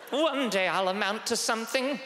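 put-on high-pitched Dickensian boy; high little British waif voice; hopeful urchin. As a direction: declare it like a plucky orphan. Dickensian boy answering the headmaster.

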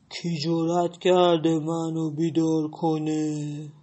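slow, low-ish angry bear growl; grumpy bear. Acted. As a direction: rumble it out like a woken bear. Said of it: "خرس با عصبانیت غرید". (خرس عصبانی، آهسته و بم)

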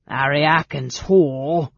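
slow, loud goblin insult; carrying goblin jeer. A narrator's reading. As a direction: jeer so that it carries across the yard. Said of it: "The goblin's voice carried clearly".

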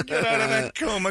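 high, shouted boxer voice; Rocky impression, calling out. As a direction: holler like a movie boxer from Jersey. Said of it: "Rocky's got this, like, Jersey".

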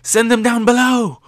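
very high, loud urgent yell. Retold or imitated. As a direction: shout the order across the battle. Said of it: "Luffy yells to Law".

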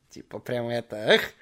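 usual speaking voice

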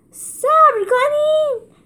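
high, loud, drawn-out yell to wait; desperate slow shout. A narrator's reading. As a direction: cry out to stop the others. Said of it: "جولیوس داد زد".

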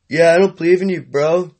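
ordinary talk, nothing marked

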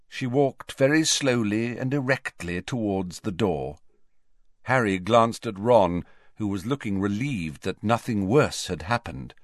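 ordinary delivery

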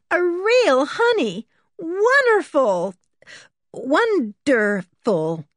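high, drawn-out rapture; rapturous. A narrator's reading. Berta admiring George's castle, rapturous. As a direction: stretch the words out in admiration. Awed visitor delighted with castle.

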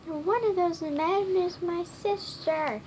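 a mild storybook shout. A narrator's reading. Skinny shouts a discovery to the other boys. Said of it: "Skinny shouted".

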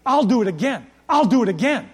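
loud, high, insistent repetition; preached refrain. Acted. Pastor to the dads, hammering the point. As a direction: drive it home twice.

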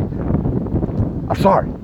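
drawn-out breathy whine of sorry; mock whiny apology. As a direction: stretch it into a mock plea. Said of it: "apologize, apologize".

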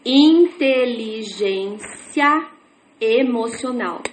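drawn out word by word, slowly; stretched syllable-by-syllable reading; slow beginner reading. Shown as a demonstration. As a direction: sound out each word like a new reader. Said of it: "eu começo a ler assim".

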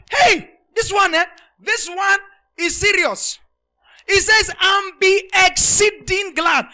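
loud, high, slow exultant preaching; full-force preaching. Acted. Preacher driving the point to his flock, loud and high. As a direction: pull the whole church up with you.

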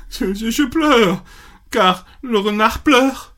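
pitched high, stammering through mock tears; a little rabbit blubbering; high tearful rabbit. Rabbit answering the bee, high and mock tearful.

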